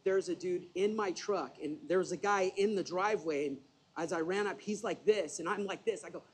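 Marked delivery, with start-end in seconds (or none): none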